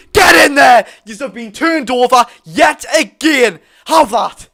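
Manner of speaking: loud gloating bellow; roaring taunt. Real, not acted. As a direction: roar it at the rival fans. Fan roars at rivals.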